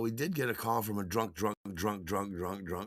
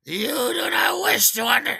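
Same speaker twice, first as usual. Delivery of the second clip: put-on riled-up drunk shout; 13 semitones higher, 12 dB louder than usual. Drunk caller riled up again at the DJ, shouting. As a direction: shout it indignantly like a tipsy caller. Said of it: "she got riled up again and said".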